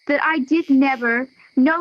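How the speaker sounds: ordinary talk, nothing marked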